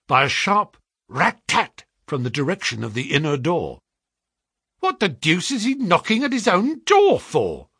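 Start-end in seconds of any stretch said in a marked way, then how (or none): 4.8-7.7 high-pitched, astonished exclamation; baffled cry. A narrator's reading. Clerk startled by knocking from inner door, cried out. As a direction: cry out in bewilderment. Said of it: "cried the clerk".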